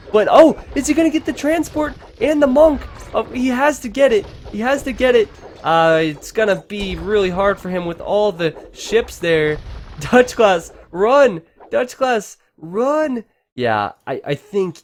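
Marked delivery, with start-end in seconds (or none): 0.1-5.3 high, loud hopeful urging; will him to grab it; excited urging. Real, not acted. Caster urging the player to catch the monk, high excited. 10.0-13.2 loud high call to the player to run. Real, not acted. Caster yelling at Dutch Class to run, loud call.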